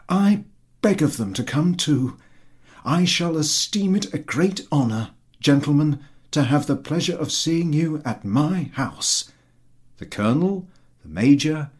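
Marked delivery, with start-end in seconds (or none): 0.1-9.3 lightly voiced fawning landowner, higher; eager flatterer. A narrator's reading. Chertakutski flattering the general about his horse, eager and higher. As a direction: fawn over the horse in a raised, eager voice.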